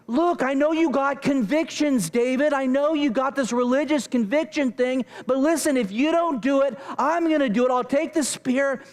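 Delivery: high-pitched, insistent argument; mock yelling. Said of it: "Okay, I'm yelling".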